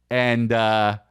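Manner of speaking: ordinary talk, nothing marked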